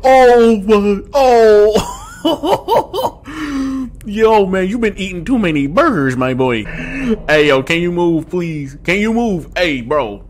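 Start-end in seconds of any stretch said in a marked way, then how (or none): 0.0-4.1 laughing in disbelief; nervous laugh. Real, not acted. Reacts to Ronald's size.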